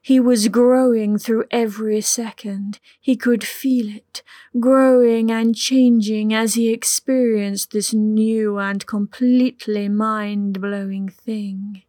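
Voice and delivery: ordinary delivery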